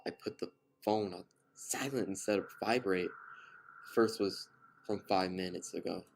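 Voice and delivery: ordinary delivery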